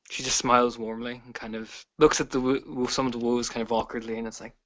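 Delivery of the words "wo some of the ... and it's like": normal talking voice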